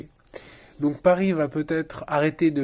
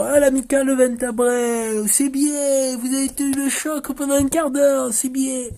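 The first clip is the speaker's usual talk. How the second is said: mock enthusiasm; 10 semitones higher, 4 dB louder than usual. Dead season by mid-year, mock delighted high voice. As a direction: imitate someone far too easily pleased. Imitating easily satisfied club or fans.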